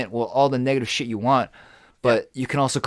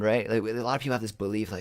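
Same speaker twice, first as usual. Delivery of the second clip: normal talking voice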